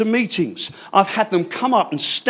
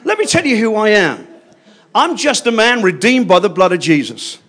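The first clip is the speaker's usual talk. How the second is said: ordinary talk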